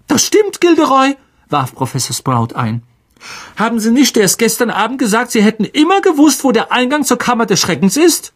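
high, fast, falsely sweet witch; needling Sprout. A narrator's reading. Sprout reminding Lockhart of his boasts, high and needling. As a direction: chirp the barb up high and quick.

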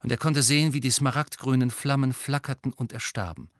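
usual speaking voice

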